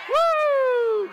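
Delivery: high, loud "woo"; real revival-meeting holler; exultant whoop. Real, not acted. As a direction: let out a piercing praise whoop. Preacher whoops in worship.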